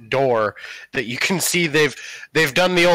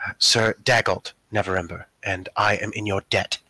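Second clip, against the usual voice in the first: lower, softer, courtly and grateful; rescued young noble; 6 semitones lower, 4 dB quieter than usual. Acted. Freed noble thanks his rescuer in a low, soft courtly voice. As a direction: introduce yourself formally, lower and softer, with relief.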